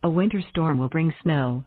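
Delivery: ordinary talk, nothing marked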